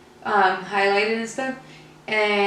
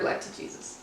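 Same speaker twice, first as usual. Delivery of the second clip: whispered; 9 dB quieter than usual. Real, not acted. Repeats phrase in whisper.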